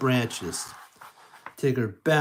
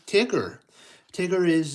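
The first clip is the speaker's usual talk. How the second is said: ordinary delivery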